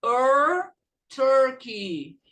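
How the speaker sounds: "er, Turkey" with a long curled r; emphatic r-colored "Turkey"; ER sound. Shown as a demonstration. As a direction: stretch the first vowel of "Turkey" as "er". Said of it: "e como U também".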